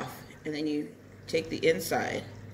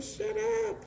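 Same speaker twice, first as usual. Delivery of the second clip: mock snap; 4 dB quieter than usual. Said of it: "I was like".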